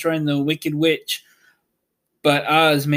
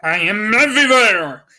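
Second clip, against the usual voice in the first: higher, louder booming wizard voice; Oz answering; 6 semitones higher, 6 dB louder than usual. A narrator's reading. The invisible wizard answering Dorothy, grand and booming. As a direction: proclaim it grandly from above. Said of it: "answered the voice".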